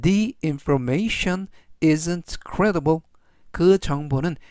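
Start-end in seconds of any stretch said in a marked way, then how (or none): 0.0-3.0 slow model sentence. Shown as a demonstration. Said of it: "전체적 의미는".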